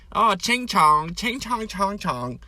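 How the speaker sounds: put-on high-pitched caricature of a Chinese accent; mock Chinese accent. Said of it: "trying to do a Chinese accent".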